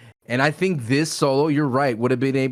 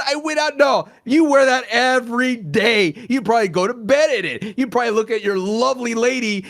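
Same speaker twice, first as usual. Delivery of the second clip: mock angry rant; 9 semitones higher, 5 dB louder than usual. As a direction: shout him down in exaggerated outrage. Mock rant at co-host's Cena shirt.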